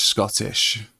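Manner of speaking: Scottish said with a Scottish shk sound. Shown as a demonstration. Streamer switching into a Scottish accent for chat. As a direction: roll into Scottish on the word Scottish. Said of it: "Oh, Scottish".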